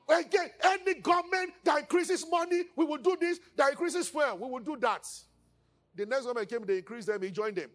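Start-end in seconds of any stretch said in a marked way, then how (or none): 0.1-5.1 mock rally shout, pitched up; mock protest rant. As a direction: ham up a protester's rally speech. Mocking protest speech at Ojota.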